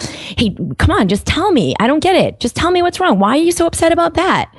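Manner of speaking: high-pitched exasperated demand; acted stressed-out adult losing patience; frustrated, uninviting. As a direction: press a child for answers with impatient, rising pitch. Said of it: "You can feel in my voice, it's not inviting".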